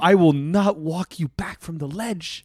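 slow, emphatic refusal; mock declaration. Friend refusing to talk a buyer down, slow mock-solemn declaration. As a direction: draw out each word like a playful vow.

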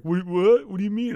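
a kid playing dumb, caught out; mock surprise. Kid confronted by teacher.

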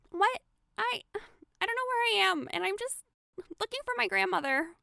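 put-on high, frightened little girl voice; lost child asking for help; scared little girl. Lost girl pleading with strangers, high scared little girl.

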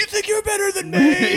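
high, loud, drawn-out rage; angry mock yell. Sandler's character beating up the coach, angry. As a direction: yell it as an over-the-top tantrum.

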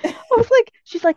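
normal talking voice

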